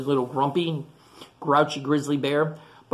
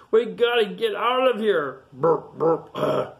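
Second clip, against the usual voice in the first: lightly voiced Sasquatch, raised then grunting; Bigfoot Sasquatch; 6 semitones higher, 4 dB louder than usual. A narrator's reading. Sasquatch wants out, lightly voiced loud exclamation. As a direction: exclaim loudly, then burp out noises. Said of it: "says the Bigfoot Sasquatch".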